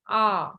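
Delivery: A as an open "ah", falling; accent grave sound. Shown as a demonstration. Teacher shows the class the sound, A said as open "ah". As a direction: give A as a French "ah". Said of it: "How is the pronunciation?".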